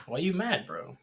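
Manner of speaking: usual speaking voice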